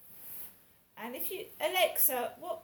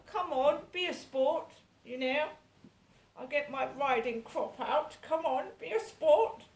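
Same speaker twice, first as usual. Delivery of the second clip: higher, louder hammed-up posh toff; 3 semitones higher, 5 dB louder than usual. Mocking the posh man who pesters her, hammed-up posh cajoling. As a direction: play a plummy cad with a riding crop. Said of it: "Doesn't that sound like a posh person".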